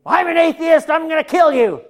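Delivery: high, loud mock threat; hostile homeowner. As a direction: play a hammed-up angry atheist.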